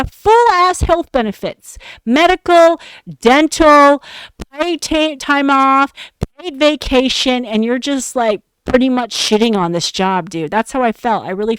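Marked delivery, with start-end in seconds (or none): 0.0-1.8 higher, pushed repeat of full ass health benefits; emphatic repeat. Real, not acted. Repeats the perk list, emphatic. 2.0-7.5 emphatic listing. Real, not acted.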